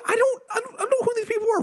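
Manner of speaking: high, whimpering mock panic; mock terrified. Imitating the frightened woman pleading with the hunter, high and whimpering. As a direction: squeak it out like a scared hostage.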